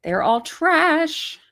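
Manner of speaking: high, slow sneering sing-song; mocking repeat. Repeating the insult to the live chat in a high, slow sneer. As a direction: drag it out high and scornful.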